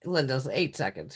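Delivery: usual speaking voice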